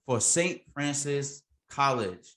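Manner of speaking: usual speaking voice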